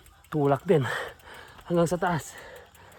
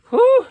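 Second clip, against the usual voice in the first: high, loud breathless whew; effort grunt; 9 dB louder than usual. Real, not acted. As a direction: blow out hard after the climb. Exhausted after climbing steep road. (hingal na buga ng hangin)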